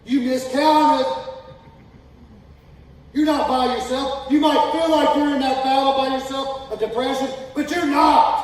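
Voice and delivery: shouted, high and slow hellfire preaching; full-force preaching. Acted. Preacher shouting God's challenge to Elijah, slow and high. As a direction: preach it at the top of your voice.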